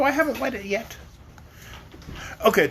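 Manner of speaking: ordinary delivery, nothing marked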